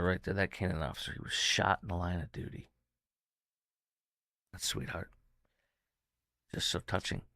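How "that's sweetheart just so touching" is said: hushed, much softer, moved; emotional whisper. Real, not acted. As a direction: whisper it through real emotion. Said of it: "I'm getting a little emotional".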